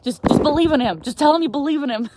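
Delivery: loud, high, hammed-up insistence; comic emphatic begging, punching each phrase; urgent pleading. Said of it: "'Cause I'll be like".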